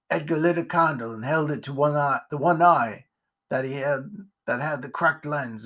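plain reading voice

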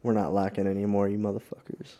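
usual speaking voice

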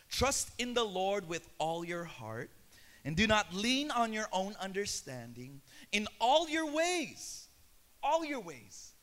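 slow, deliberate reading of the verse; scripture reading. Acted. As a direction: read it out slowly, like holy words. Reads Proverbs slowly as scripture.

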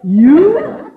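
high, loud disbelief; can't believe he picked himself; shocked. Acted. Miss Brooks stunned the principal is going, shocked.

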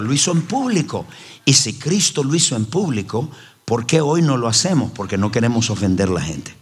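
usual speaking voice

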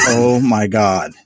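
slow dawning realization. Retold or imitated. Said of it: "And I'm like".

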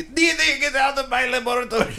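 very high, fast cartoon boy-genius squeak; squeaky little genius yelling at his sister; Dexter impression. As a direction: shriek it like a bratty cartoon kid scientist. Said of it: "He sounds like Dexter".

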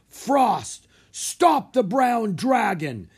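loud, high, slow command; giant's shout. A narrator's reading. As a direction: bellow the order. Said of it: "he shouted".

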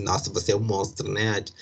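ordinary talk, nothing marked

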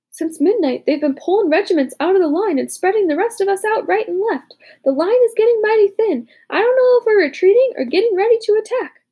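a nervous soldier talking fast; worried soldier. A narrator's reading. Soldier voice in story.

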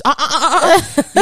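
high excited ah ah ah cheering; high hype chant. Retold or imitated. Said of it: "hear Jules' voice".

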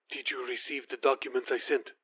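a prince on the phone, quiet and commanding; commanding near-whisper. Acted. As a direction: give the order in a breath, quiet and firm. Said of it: "just above a whisper, though clearly commanding".

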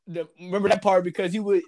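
ordinary talk

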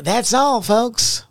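high, loud cartoon closing line; cartoon sign-off. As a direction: belt it out like the end of a cartoon.

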